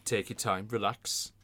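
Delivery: a light darts commentator impression; darts commentator parody. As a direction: ape a hushed darts commentator. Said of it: "dart commentator parody".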